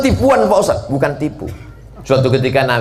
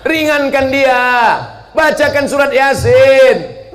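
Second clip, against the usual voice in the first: higher, louder pleading; urgent plea; 14 semitones higher, 4 dB louder, slower than usual. Acted. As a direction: beg the family to stop. Urging family at deathbed. (seruan mendesak yang lebih tinggi)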